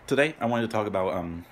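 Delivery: talking through chewing; fast, rushed with candy in mouth; mouth full. Real, not acted. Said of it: "still not done chewing".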